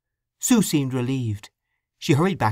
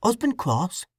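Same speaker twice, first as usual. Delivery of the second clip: lightly voiced kindly old widow, a bit higher; a hint of a motherly country widow; gently concerned old woman; 6 semitones higher than usual. Widow speaks to Sue.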